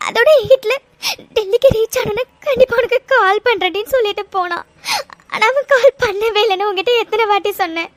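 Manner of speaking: frightened, sobbing confession to a friend; tearful and scared; crying. Acted. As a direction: talk through tears, with sobs between phrases. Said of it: "இன்னும் எவ்வளவு நேரம் தாண்டி அழுதுட்டு இருப்ப".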